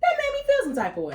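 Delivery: very high, fast squeal of protest; high-pitched indignation. Real, not acted. Woman venting about being judged, high indignant squeal. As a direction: rush it out way up high.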